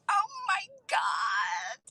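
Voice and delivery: high, drawn-out excited squeal; excited outburst. Real, not acted. Host thrilled about a Disney birthday trip, excited squeal. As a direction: squeal it slowly with real excitement.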